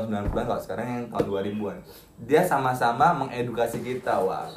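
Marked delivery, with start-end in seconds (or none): none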